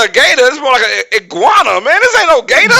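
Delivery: high, loud mock outrage; comic outraged disbelief; indignant disbelief. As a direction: protest incredulously, building to a shout. Acting out his disbelief at logo.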